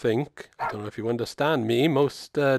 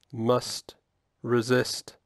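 slow, word by word, lower; strained self-restraint; 4 semitones lower, 5 dB quieter, much slower than usual. Acted. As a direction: resist temptation with a pause between words. Dog fighting an urge.

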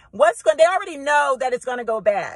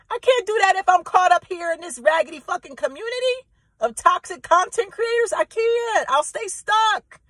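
high heated rant; 7 semitones higher than usual. Real, not acted.

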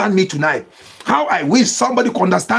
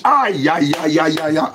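wailing cry; 5 semitones lower than usual. Real, not acted.

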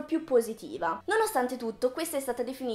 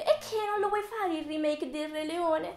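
Disney's mock reply; 10 semitones higher than usual. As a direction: answer as the company in a pleading, high put-on voice. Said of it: "La Disney risponde".

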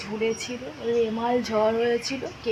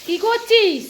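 calling out; 11 semitones higher, 6 dB louder, slower than usual. Real, not acted. Calling to someone nearby.

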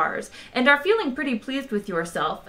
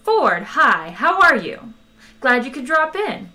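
breezy, higher-pitched casual greeting; affected nonchalance; 5 semitones higher, 5 dB louder than usual. A narrator's reading. Zaphod greets Ford as he drops in, affected nonchalance. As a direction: put on a show of being unbothered. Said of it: "affectation of nonchalance".

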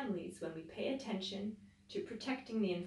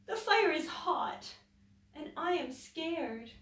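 high, slow, tearful little voice; a frightened rabbit close to tears; sobbing rabbit; 7 semitones higher, 5 dB louder, slower than usual. Acted. Scared Rabbit asks the others for help, sobbing. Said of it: "sobbed Rabbit".

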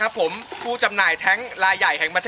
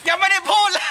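startled protest; 9 semitones higher, 3 dB louder than usual. Real, not acted. Ice water dumped too early.

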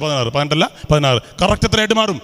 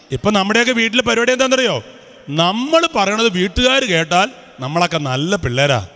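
ordinary talk

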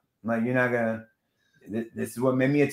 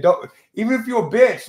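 imitating a Jersey accent, pitched up and louder; mock Jersey accent, high and punchy; 7 semitones higher, 6 dB louder than usual. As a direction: throw the Jersey accent back at him, loud. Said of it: "you were very Jersey when you said".

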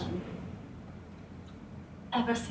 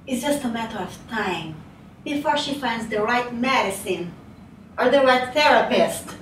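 rising to loud, higher insistence; building desperate hope; 3 semitones higher, 7 dB louder than usual. Acted. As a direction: build forcefully, clinging to hope. Recalling her desperate hope for recovery.

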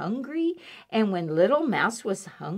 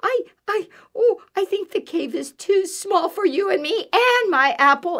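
high, loud, stammering squeak; squeaking mouse; 11 semitones higher, 8 dB louder than usual. A narrator's reading. Little Mouse backing away from Bear, flustered squeak. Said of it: "squeaked Little Mouse".

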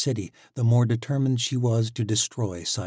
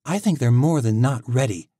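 sharp retort; 5 semitones higher, 8 dB louder than usual. Acted. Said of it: "Tori shot back".

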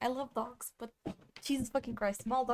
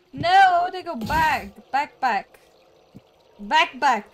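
loud urgent calls; 11 dB louder, slower than usual. Real, not acted. Gamer shouting at character to go back. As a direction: bark back back, loud and insistent.

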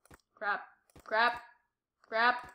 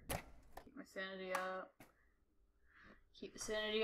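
breathy unvoiced whisper; 2 semitones lower, 12 dB quieter than usual. Real, not acted. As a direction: murmur it so nothing hears. Whispering to himself in horror game.

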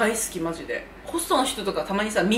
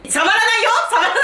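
indignant denial; 10 semitones higher, 4 dB louder than usual. Real, not acted.

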